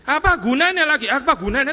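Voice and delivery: voicing Jesus in a mood swing; exasperated. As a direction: fling it out in frustration. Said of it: "Mood swing dong".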